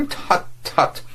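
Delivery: sharp, high disapproving tut-tut; clipped tutting rebuke; frowning tut-tut. Acted. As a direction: cut him off with irritation. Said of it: "broke out Mister Vladimir with a frowning grimace".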